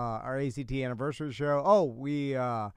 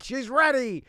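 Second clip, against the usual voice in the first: announcing; 8 semitones higher, 5 dB louder than usual. Retold or imitated. Game of Thrones quote, acted.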